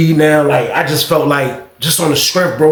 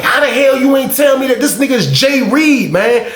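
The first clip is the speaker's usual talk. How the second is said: exasperated shout; 7 semitones higher than usual. Real, not acted. Outraged at his friend.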